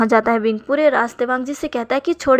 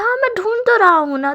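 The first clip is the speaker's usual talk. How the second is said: put-on high, louder drunken protest; tipsy whine; 10 semitones higher, 4 dB louder than usual. Drunk Wing whines back at his boss, high-pitched.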